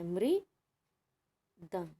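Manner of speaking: मृदंग stretched very slowly with a clear mri sound; teacher sounding out मृदंग syllable by syllable; slow model word. Shown as a demonstration. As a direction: draw out मृदंग, stressing the mri. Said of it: "ये री की sound देता है".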